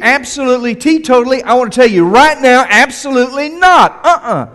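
loud, high insistent preaching; preacher insisting to the congregation, loud; fervent preaching. Real, not acted. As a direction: hammer the no home. Preaching at full force.

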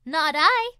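loud careless refusal; a lightly voiced duck brushing off; dismissive duck. A narrator's reading. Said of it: "said the duck".

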